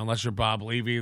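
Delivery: ordinary delivery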